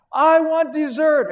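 put-on boy screaming for dessert at dad; child's scream. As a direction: scream it like a toddler in a tantrum. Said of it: "he's screaming at the top of his lungs".

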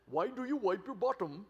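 punchline in a put-on Indian accent; Indian dad retort. Dad looks at his son and replies, mock Indian accent. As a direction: answer back as a comic Indian-accented dad. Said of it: "his dad spoke kind of the Indian dialect".

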